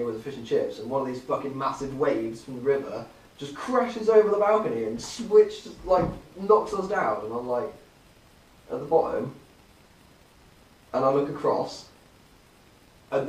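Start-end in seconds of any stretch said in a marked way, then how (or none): none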